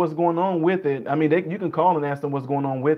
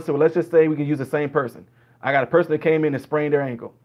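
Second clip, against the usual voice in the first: normal talking voice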